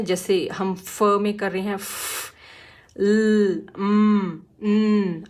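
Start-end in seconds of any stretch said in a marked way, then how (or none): 3.0-4.4 ल and म held with no vowel; pure consonant sounds. Shown as a demonstration. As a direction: give ल and म as bare sounds. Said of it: "सिर्फ sound निकालनी है".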